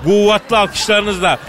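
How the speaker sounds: announcer shout. Acted. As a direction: belt it out to the crowd. Host calls for applause.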